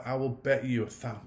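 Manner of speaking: ordinary delivery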